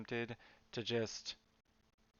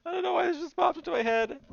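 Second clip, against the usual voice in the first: much higher, louder, squeaky cartoon voice; silly squeaky voice; 6 dB louder, faster than usual. An artist repeating her own words to herself in a silly high voice. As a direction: replay the thought as a goofy squeak.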